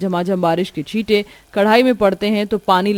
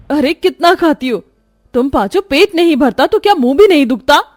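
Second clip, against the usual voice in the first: higher and louder, fed-up rebuke; exasperated scolding; 8 semitones higher, 7 dB louder than usual. Acted. Bhabhi to the greedy nanads, louder exasperated scolding.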